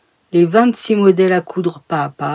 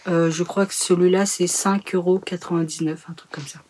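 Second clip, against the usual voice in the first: normal talking voice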